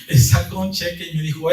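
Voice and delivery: ordinary talk, nothing marked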